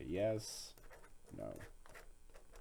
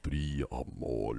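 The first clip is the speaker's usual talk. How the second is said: low whispered vampire rasp; Nosferatu; 9 semitones lower, 5 dB quieter than usual. Acted. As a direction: whisper it slowly like an old vampire. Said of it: "That's my Nosferatu impression".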